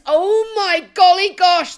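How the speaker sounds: high, loud hammed-up gasp of outrage; sarcastic fake surprise, loud and high; mock shock. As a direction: gush it out like a scandalised auntie.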